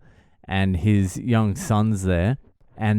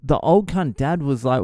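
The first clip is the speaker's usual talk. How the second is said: usual speaking voice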